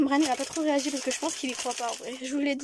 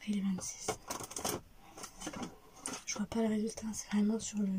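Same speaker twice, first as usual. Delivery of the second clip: hushed whisper while waiting; 4 semitones lower, 11 dB quieter than usual. Real, not acted. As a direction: whisper under the breath.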